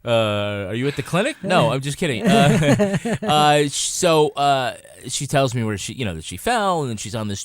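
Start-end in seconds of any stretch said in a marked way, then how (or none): none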